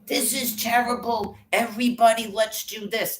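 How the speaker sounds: high, whiny mock official voice. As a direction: sneer it in a squeaky mocking voice.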